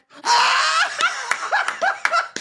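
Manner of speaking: high squealing burst of laughter; loud high shriek of a laugh. Real, not acted. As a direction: shriek with delight.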